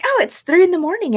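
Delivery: chirpy roommate. Retold or imitated. Roommate answering the groggy narrator, high and mock-chipper. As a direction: play the roommate as cheerfully oblivious at three a.m. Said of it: "she's like".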